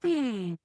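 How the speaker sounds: gentle falling exhale; demonstrated soft sigh; soft, full sigh. Shown as a demonstration. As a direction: sigh out slowly and fully. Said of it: "that's soft and slow and full".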